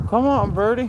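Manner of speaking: high coaxing call to Birdie; calling the dog. Real, not acted. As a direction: coax the dog over, way up high.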